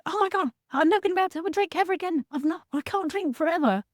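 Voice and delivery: fast, high, hammed-up panic about never drinking again; mock panic. Coach voicing a newly sober person's panic, in fast mock alarm. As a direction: gabble it out like a mock-terrified drinker.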